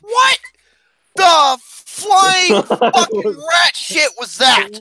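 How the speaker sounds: high, loud, drawn-out outburst; exaggerated disbelief. Real, not acted. Host reacting to a mystery noise, exaggerated disbelief. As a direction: stretch each word out in loud disbelief.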